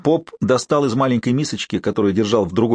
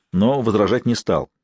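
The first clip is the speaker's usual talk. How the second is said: normal talking voice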